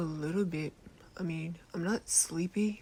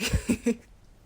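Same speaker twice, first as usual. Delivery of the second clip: loud, higher burst of giggles; laugh; 5 semitones higher, 10 dB louder than usual. Acted. Apologising to the listener while still laughing.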